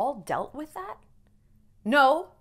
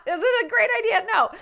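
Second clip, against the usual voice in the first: laughing; 10 semitones higher, 5 dB louder than usual. Real, not acted. Laughs at herself.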